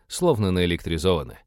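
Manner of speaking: ordinary delivery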